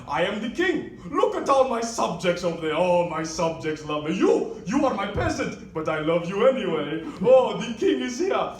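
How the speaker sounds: put-on self-proclaimed king holding court in a Ugandan accent; loud, swooping mock-regal Ugandan accent; thick Ugandan accent. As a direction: address your subjects grandly, imitating a Ugandan accent. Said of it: "he starts telling everyone".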